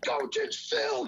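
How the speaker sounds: slow, very high strained wail; mock screaming player. Player protests to coach over film, drawn-out mock scream. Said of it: "they start screaming".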